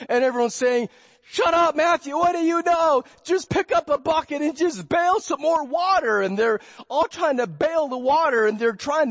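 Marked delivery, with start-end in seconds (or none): 1.3-6.2 mock shout, higher and louder; angry retort. Disciples shouting down Matthew mid-storm, higher mock yell. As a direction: snap at him to shut up and bail.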